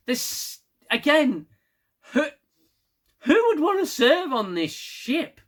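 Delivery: rising high, exasperated disbelief; incredulous outcry. Real, not acted. Recapping the episode to viewers, exasperated disbelief. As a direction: throw up your hands asking who would serve here. Exasperated rant about dangerous ship.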